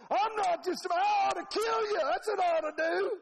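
high, loud country tirade; hammed-up furious threat; really mad Balaam. Balaam answers his donkey back, mock furious yelling. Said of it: "when they get really mad".

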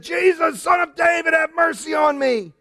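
high, pleading shout; crying out. Retold or imitated. Preacher voicing the blind beggar's loud, high plea. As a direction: call out for mercy at the top of the voice. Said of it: "he began to yell really loud".

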